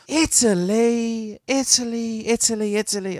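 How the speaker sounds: hammed-up Italian, pitched high; Italian accent. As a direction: play with the word in a put-on Italian accent. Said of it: "Italian accent indoors with the kids".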